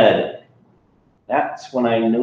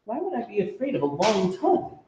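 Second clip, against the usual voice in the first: put-on child voice; 10 semitones higher, 7 dB quieter, much faster than usual. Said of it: "I asked".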